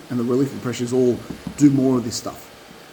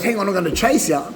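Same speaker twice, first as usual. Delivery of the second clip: eager dog; 7 semitones higher than usual. Acted. Dog voiced, eager to chase.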